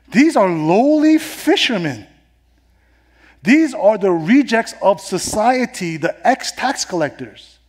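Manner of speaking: high, loud, incredulous pulpit voice; impassioned sermon with punched key words; forceful preaching. Acted. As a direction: drive each phrase home to the congregation.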